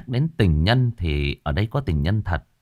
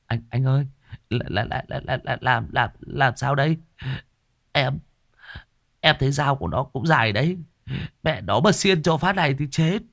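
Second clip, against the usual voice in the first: panicky stammer; 5 semitones higher than usual. A narrator's reading. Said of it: "cũng bắt đầu quýnh".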